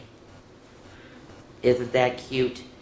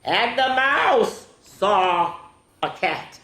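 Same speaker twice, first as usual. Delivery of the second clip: very slow, high swoop on the mouse; dramatic picture-book reading; 9 semitones higher, 6 dB louder, much slower than usual. A narrator's reading. Reading to children that the mouse saw a cat, dramatic storytime voice. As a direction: draw it out high and suspenseful, then drop.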